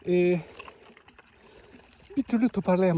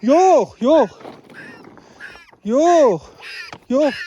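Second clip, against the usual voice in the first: loud, higher-pitched "no" to an animal; real raised-voice refusal; insistent no; 4 semitones higher, 4 dB louder, much slower than usual. Real, not acted. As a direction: call out a drawn, firm no. Tells dog no, puppy crying.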